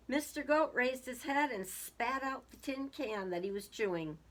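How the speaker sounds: plain reading voice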